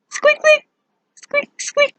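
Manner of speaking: very high, short squeaks; mouse squeaking. Shown as a demonstration. Teacher showing what a mouse says, squeaking. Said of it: "Mouse says".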